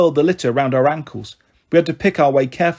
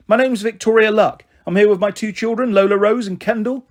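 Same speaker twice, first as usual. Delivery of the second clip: a touch higher, careful and formal; formal introduction; 6 semitones higher than usual. A narrator's reading. Mum introducing herself to the school intercom, careful. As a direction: speak up politely to the wall. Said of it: "cleared her throat and spoke to the brick wall".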